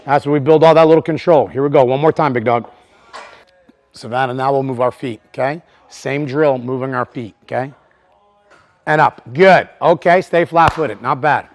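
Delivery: loud, high calls to the lifters; coaching calls. Real, not acted. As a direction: keep cueing them through each rep. Coach cueing several lifters live.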